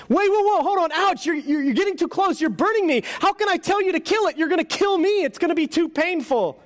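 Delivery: high, panicky pleading voice; a scared ghost begging the angel to stop; frightened ghost. Acted.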